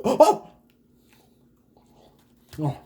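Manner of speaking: sharp gasp from a burning bite; pained gasp. Real, not acted. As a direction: yelp as the hot filling burns. Burning hot bite in mouth.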